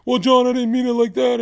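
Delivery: lightly voiced whining excuse; mocking whiny excuse. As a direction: mimic a weaselly friend backpedalling.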